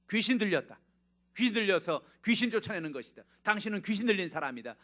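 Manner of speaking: high, loud accusation; accusing Pharisees. Retold or imitated. Pharisees accuse Jesus of being possessed, loud and high. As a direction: hurl the charge at him.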